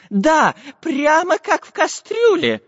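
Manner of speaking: high, slow professor voice agreeing; excited agreement. Acted. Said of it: "согласился географ".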